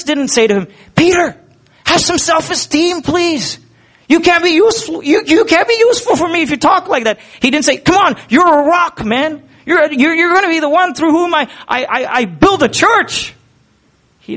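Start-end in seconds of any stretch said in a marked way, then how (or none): 1.0-7.2 high, mock-cheerful pep talk; mocking voice of God. Preacher mocks a God who flatters Peter, high mock cheer. 7.8-13.3 high, mock-cheerful pep talk; mocking voice of God. Preacher mocks a God who flatters Peter, high mock cheer.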